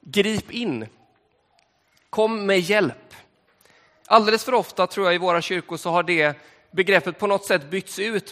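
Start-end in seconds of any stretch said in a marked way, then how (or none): 2.1-3.2 slow, weighty kom med hjälp; emphatic meaning. Shown as a demonstration. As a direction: spell out the meaning, word by word. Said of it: "det betyder".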